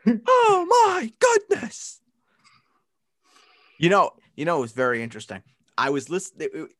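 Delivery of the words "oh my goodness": very high, slow drawn-out exclamation; hammed-up commentator gasp; Ian Eagle call. Answering the co-host's Ian Eagle call with a high, slow exclamation. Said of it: "Ian Eagle impression".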